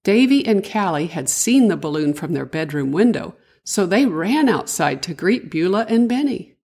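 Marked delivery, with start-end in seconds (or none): none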